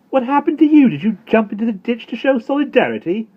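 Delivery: exasperated mother. Acted. Mother turns on her second muddy son, loud and high sarcasm. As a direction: needle him with sarcasm.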